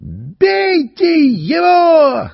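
very high, slow, loud shriek; old woman shrieking. Acted. An old woman shrieks at the crowd to attack. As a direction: screech for blood like an old woman. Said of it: "завизжала старуха".

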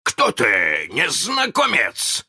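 high, drawn-out challenge; shouting. A narrator's reading. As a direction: call out to the unseen stranger. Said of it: "закричал он".

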